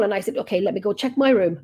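ordinary talk, nothing marked

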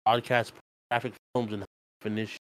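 choppy cutting-out mic; glitching audio. Real, not acted. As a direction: voice dropping in and out. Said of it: "You keep cutting out".

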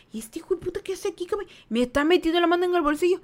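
fast, high indignant disbelief; retold what-is-this-guy-doing thought, rushed and high; incredulous inner thought. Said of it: "en mi cabeza fue como que". (pensamiento incrédulo e indignado, rápido y agudo)

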